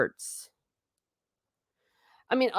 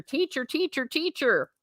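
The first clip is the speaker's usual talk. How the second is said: mock screaming; 8 semitones higher, 4 dB quieter than usual. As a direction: plead for the teacher in a high voice. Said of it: "You're screaming at the teacher".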